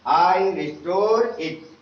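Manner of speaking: "I restore it" said very slowly, stressing "restore" as res-TOR; slow spelled-out reading. Shown as a demonstration. Teacher slowly models the prayer line for pupils. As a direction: read it word by word for the class, stressing "restore". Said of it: "ई silent".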